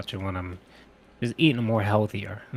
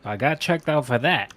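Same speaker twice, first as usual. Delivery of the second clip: ordinary talk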